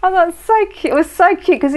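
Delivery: high, loud delighted gush. Real, not acted. Traveller recalling the petrol station man's accent, gushing. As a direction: squeal it with delight, pitched right up.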